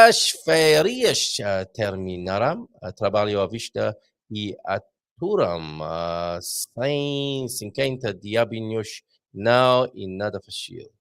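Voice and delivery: slow careful Portuguese reading; reading Portuguese aloud. Retold or imitated. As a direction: sound out the Portuguese words slowly. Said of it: "I don't know what she".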